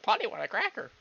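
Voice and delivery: lightly squawked parrot line; a playful hint of pet parrot. Parrot phrase done as impression.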